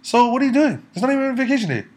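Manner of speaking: high, loud indignant outburst; shrill disbelief at the news; outraged. Real, not acted. Outraged at friend's work.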